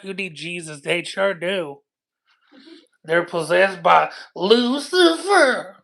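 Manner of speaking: low, slow preachy lady voice; a deep-voiced church lady scolding trolls; churchy lady. Low church lady telling trolls they need Jesus.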